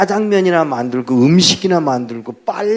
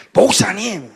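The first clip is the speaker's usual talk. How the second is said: higher, sharp exclamation of the pastor's title; angry outburst; 8 semitones higher, slower than usual. Retold or imitated. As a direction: scold him by name in shock. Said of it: "화를 내더라고요". (화난 외침)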